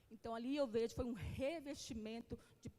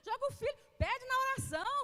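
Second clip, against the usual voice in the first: higher, louder, driving it home; loud exhortation; 10 semitones higher, 8 dB louder, faster than usual. Real, not acted. Preacher urges parents in the congregation, loud and high. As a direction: push the congregation hard.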